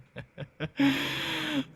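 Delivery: high, loud wild cackle; maniacal laugh. Acted. Vampire giving in to bloodlust, wild cackle. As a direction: let the hunger break out in a wild cackle.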